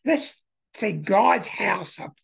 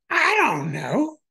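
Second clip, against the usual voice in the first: slow, higher, unsure rising shrug; hesitant mock Dylan; 8 semitones higher, 5 dB louder than usual. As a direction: drag it out as if puzzled. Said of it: "they can't answer their stupid questions".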